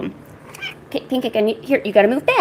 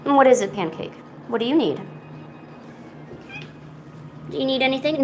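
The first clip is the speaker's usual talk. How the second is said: normal talking voice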